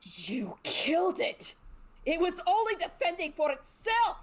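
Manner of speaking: dramatic wounded reproach; accusing outcry. Acted. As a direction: cry it out in character, rising high. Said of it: "she looks at him and is like".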